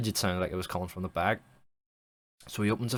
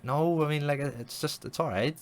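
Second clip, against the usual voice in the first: higher-pitched acted backpedalling; mock defensive husband; defensive placating; 7 semitones higher than usual. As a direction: brush off the criticism, voice lifted. Husband placating his sceptical wife.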